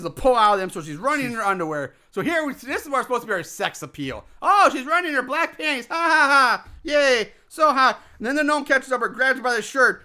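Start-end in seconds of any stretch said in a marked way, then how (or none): 4.4-8.0 leering mock cheer. Mocking leering filmmakers.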